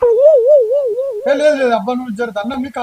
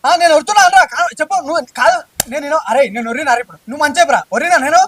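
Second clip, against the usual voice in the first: angry quarrel; 2 semitones higher, 5 dB louder than usual. Acted. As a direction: demand an answer, voice cracking upward. Quarrel denying he shouted.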